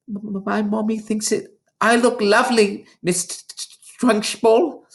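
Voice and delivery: high, trembling stammer; a little girl stuttering to the headmistress; scared stutter. A narrator's reading. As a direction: stammer the words out in fright. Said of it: "Amanda stuttered".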